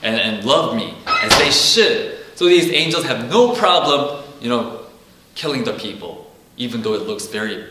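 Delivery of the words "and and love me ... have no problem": louder, higher preaching, pressing the point; preaching at full force. Acted. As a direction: drive the judgment home from the pulpit. Preaching on sin and judgment.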